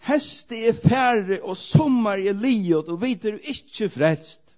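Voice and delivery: loud, slow and drawn out, a prophet's lament; crying out. Retold or imitated. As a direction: call it out slowly to the people, high and loud. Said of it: "Ropar og skrat". (høyt, langsomt rop)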